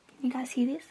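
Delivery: hushed aside to the camera; whisper. Real, not acted. Vlogger checking if viewers hear the wand, whispering. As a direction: whisper so the mascara buzz can be heard. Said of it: "if that's gonna be loud enough to hear".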